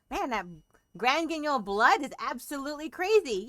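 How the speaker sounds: ordinary talk, nothing marked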